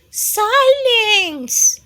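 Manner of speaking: eerie high silence. Acted.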